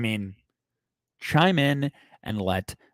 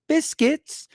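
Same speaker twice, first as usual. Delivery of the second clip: put-on very high little British child's voice; British kid impression. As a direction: squeal it like a British kid, in a bad accent. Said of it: "from The Conjuring two, the little kids".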